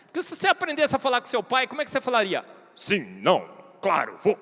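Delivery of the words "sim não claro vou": much lower, curt clipped answers; terse father. Retold or imitated. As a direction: play a man of few words, low and short. Imitates a terse father speaking.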